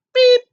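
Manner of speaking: very high, loud toot; truck beep. A narrator's reading. Blue answers the goat, loud beep. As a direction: blast a short high beep. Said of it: "Blue said".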